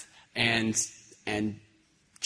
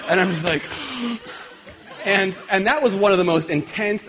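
laughing; 7 semitones higher, 5 dB louder than usual. Real, not acted. Laughs while retelling the attack.

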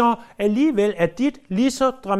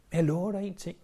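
ordinary talk, nothing marked

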